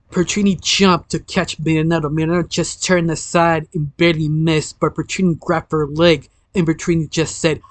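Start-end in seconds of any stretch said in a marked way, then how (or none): none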